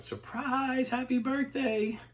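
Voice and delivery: high, drawn-out party cheer; shouted surprise. Retold or imitated. Group surprising Debbie at the coffee shop, high excited shout. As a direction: sing out the surprise greeting. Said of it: "they shouted".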